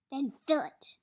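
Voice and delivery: sharp command. Acted. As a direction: snap it out. Warden commanding.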